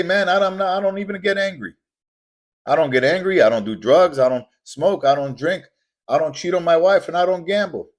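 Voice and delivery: loud, high-pitched mock denial; protesting listener. Listener insisting he has no vices, loud mock protest. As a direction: imitate an indignant objector, voice pushed high and loud.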